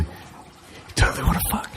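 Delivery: mock theater whisper. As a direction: lean in and whisper it. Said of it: "I leaned over and whispered".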